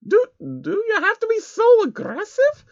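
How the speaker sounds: timid high stammer; asked quietly. A narrator's reading. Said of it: "she asked quietly".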